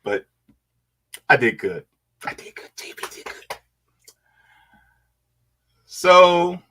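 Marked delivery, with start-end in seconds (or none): 2.2-3.6 hushed, voiceless self-congratulation; soft whispered aside, under the breath. Real, not acted. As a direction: whisper it to yourself. Repeats self-praise to self, whispered.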